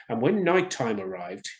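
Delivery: ordinary delivery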